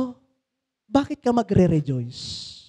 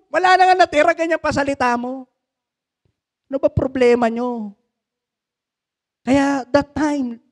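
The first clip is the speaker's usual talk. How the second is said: ordinary talk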